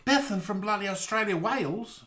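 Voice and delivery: high put-on Aussie; mock disbelief in an Australian accent. Querying Bethan about Wales, mock Australian accent. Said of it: "We've been doing Australian accents to you all evening".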